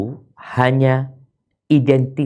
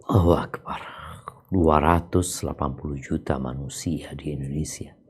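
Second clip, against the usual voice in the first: hushed, breathless whisper of wonder; awed whisper; 8 semitones lower, 8 dB quieter than usual. Acted. Preacher to audience, whispering in awe.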